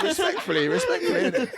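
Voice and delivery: laughing repeat. Real, not acted.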